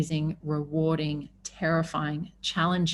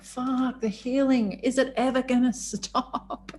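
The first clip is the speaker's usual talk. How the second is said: exasperated; 7 semitones higher, slower than usual. Retold or imitated. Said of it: "I was like".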